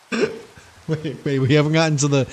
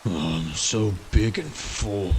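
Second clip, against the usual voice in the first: zombie impression; 6 semitones lower, 6 dB quieter, slower than usual. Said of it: "the zombie impression".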